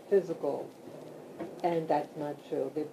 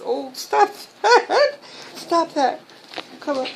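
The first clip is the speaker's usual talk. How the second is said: much higher and louder, half laughing at the dogs; laughing scold; 12 semitones higher, 8 dB louder than usual. Real, not acted. Scolding excited dogs on bed.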